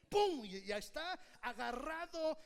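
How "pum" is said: loud high pum of a hook catching; a sharp, loud sound effect. Retold or imitated. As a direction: snap it out like a sudden catch. Hook catching a falling worker.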